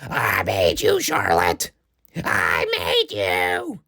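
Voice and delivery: imitating her strained, crying wail; mock the wrestler's whiny raspy screech; mock raspy scream. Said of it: "she kept screaming shit like".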